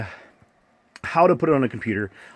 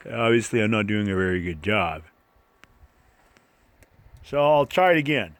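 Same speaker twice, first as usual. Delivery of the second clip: ordinary talk, nothing marked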